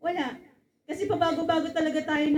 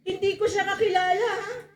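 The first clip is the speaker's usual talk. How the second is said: higher, louder nervous denial; 6 semitones higher, 3 dB louder than usual. Acted. Peter insisting he doesn't know Jesus. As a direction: brush it off, a little panicked.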